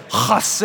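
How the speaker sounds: "hesed" with a scraping, throat-clearing H; coughed-up "hesed". Shown as a demonstration. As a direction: start "hesed" as if coughing something up. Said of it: "you're coughing something up and just say".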